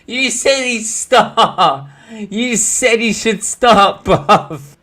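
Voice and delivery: high, drawn-out words through laughter; incredulous laughing protest. Real, not acted. Reminding his mate what he said, high laughing protest. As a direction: stretch the accusation out, laughing. Teasing mate over contradiction.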